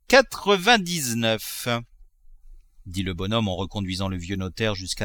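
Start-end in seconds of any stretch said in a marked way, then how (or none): 0.1-1.7 slow, higher, relishing each syllable of quatre-vingt-dix-neuf; savouring. Acted. Miser repeating the price to himself, slow relish. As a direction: roll the number round like a sweet.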